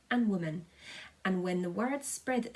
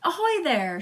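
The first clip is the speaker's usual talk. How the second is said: loud, high hail upward; shout; 10 semitones higher, 9 dB louder than usual. A narrator's reading. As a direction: call up to the roof at full voice. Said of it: "shouted the chief of police".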